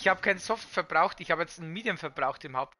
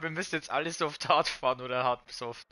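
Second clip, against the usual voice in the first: ordinary delivery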